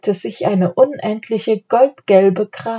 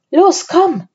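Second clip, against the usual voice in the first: higher, urgent prodding; urging; 10 semitones higher, 3 dB louder than usual. A narrator's reading. Anne hurrying her brother out, urging. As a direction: hurry someone along in a raised pitch. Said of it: "drängte Anne".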